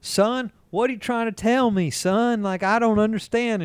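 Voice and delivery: high-pitched Southern mother imitation; bad Southern accent. Mother questioning her son coming out, exaggerated Southern accent. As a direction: put on a thick, high Southern twang. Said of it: "the worst Southern accent".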